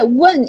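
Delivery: "wen" thrown down like a yell; sharp, loud falling "wen"; yelled fourth tone. Shown as a demonstration. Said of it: "is like you're yelling".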